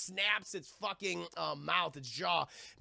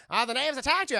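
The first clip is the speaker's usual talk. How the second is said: louder, higher acted exclamation; mock alarm; 6 semitones higher, 9 dB louder, faster than usual. The crew talk of the natives' attack, raised mock alarm.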